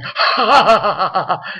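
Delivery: lightly voiced evil laugh; mock the villain's cackle; hammed-up gloating laugh; imitated villain laugh. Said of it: "you hear him laughing".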